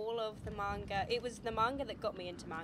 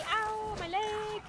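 high, slow drawn-out ow; pained cry; 10 semitones higher, 5 dB louder, much slower than usual. Real, not acted. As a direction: cry out in pain. Hurt by monster.

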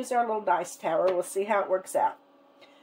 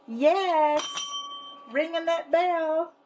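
excited cheer; 7 semitones higher, slower than usual. Real, not acted. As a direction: celebrate the win. Hits savings goal, rings bell.

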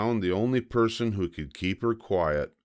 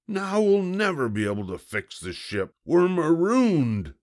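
despairing pirate; 11 semitones higher, 4 dB louder than usual. A narrator's reading. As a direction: lament it as a doomed captain.

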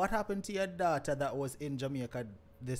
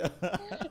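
real laugh; 11 semitones higher than usual. Real, not acted. Host laughs at the impersonation.

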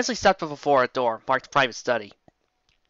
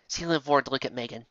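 plain reading voice

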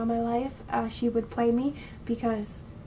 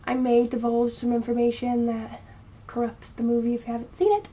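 ordinary talk

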